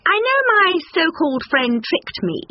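high, indignant stork voice; reply as a wronged high-voiced bird; high-pitched bird character. A narrator's reading. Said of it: "replied Stork".

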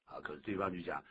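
ordinary delivery

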